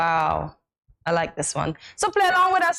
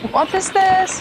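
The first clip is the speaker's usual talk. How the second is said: high, loud bewildered question; shocked; 11 semitones higher, 5 dB louder than usual. Real, not acted. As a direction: squeal of disbelief. Reacting to video.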